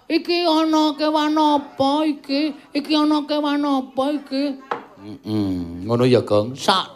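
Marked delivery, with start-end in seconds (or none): 0.1-4.4 high, put-on voice of Yuyu Kangkang; crab monster voice. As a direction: play the giant crab, wondering aloud. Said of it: "engko terus yuyu Kangkang teko".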